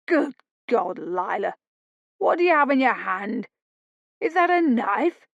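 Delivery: can't believe his eyes; disbelief. Acted. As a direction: Tom aghast at his sister. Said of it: "He gave a gasp of disbelief".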